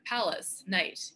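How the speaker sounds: usual speaking voice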